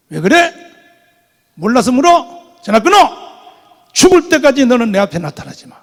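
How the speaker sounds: loud furious telephone tirade, retold; angry shouting. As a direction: bark it like an enraged senior pastor. Angry pastor hangs up on him.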